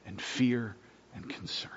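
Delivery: hushed, breathy whisper of the word fear; soft pastoral whisper. Real, not acted. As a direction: drop to a whisper for the burdens. Softly listing sufferings in sermon.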